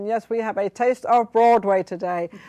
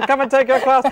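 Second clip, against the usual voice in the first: mock advert pitch; 3 semitones higher, 4 dB louder than usual. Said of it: "that's a good advertising".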